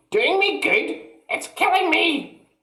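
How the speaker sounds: put-on very high, loud old woman's shriek; panicked shout. A narrator's reading. Grandma shrieking for help, very high and loud.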